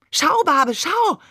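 "Schau, Babe, schau!" rising high and loud; proud little boy calling his dad to look; excited child. A narrator's reading. Little brother shows his date man to his father, excited and high.

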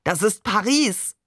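lightly voiced, elated cry; excited exclamation. A narrator's reading. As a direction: exclaim it with mild elation. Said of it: "rief Barbe".

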